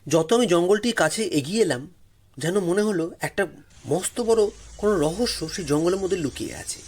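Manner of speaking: ordinary delivery, nothing marked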